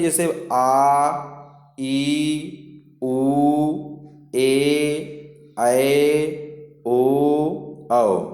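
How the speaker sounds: आ ई उ ए ऐ ओ औ each held long and slow; long vowel demo. Shown as a demonstration. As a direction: draw every long vowel out slowly. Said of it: "इसमें दो मात्राएं पाई जाती हैं".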